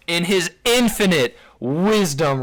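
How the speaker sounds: loud, slow sarcastic grandeur; mock reverence. Real, not acted. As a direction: draw it out with mock awe. Mock praise introducing Jason's reply.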